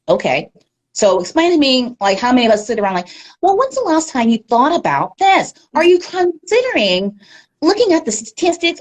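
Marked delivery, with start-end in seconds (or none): 3.4-8.8 high, stiff broadcast-anchor impression; mocking anchor voice. Mocking how journalists supposedly talk, in a high stiff anchor voice. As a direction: parody the formal newsroom delivery. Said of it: "you don't talk like this normally".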